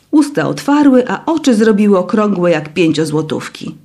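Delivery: ordinary delivery, nothing marked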